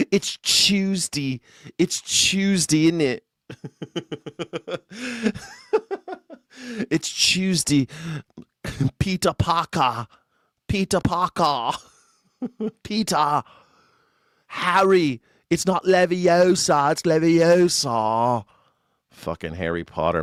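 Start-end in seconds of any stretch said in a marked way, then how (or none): 0.1-3.2 slow, high mock London accent; mock English accent. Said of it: "I talk like Harry Potter". 6.9-18.4 high, slow hammed-up English accent; mock English accent. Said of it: "I talk like Harry Potter".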